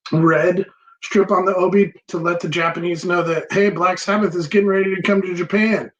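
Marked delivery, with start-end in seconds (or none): none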